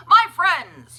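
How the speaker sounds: loud, high ringing call; booming salesman greeting; clear keen announcement. A narrator's reading. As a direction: announce it like a showman. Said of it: "he announced in a voice clear and keen".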